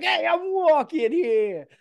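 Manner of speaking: loud, high, drawn-out New York shout; hammed-up New Yorker yelling at traffic; brash cartoon New York accent. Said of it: "he's playing a New York pilot".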